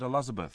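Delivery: "Elizabeth." with a Kiwi vowel, said as an example. Shown as a demonstration. Host pointing out his Kiwi accent, Kiwi "Elizabeth.". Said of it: "another bit of Kiwi. I said".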